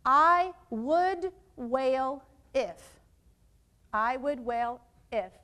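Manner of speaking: very slow, word by word; measured dictation with long gaps; slow modelling. Shown as a demonstration. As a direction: space out each word for the class to copy. Models sentence starter for partners.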